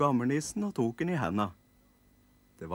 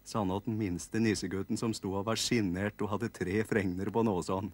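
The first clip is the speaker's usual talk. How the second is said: ordinary delivery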